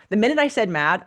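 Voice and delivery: ordinary talk, nothing marked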